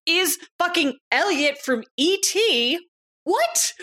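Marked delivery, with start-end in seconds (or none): 3.2-3.7 very high-pitched disbelief; incredulous. Pretend. Co-host marvels at Elliot from E T, high incredulous squeak. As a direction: squeak out your astonishment, rising at the end. Mock disbelief at actor's identity.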